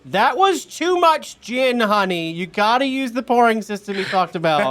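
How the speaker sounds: husband calling loudly from the back; shouting husband from kitchen. Acted. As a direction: yell it through the swing doors to your wife. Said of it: "you hear".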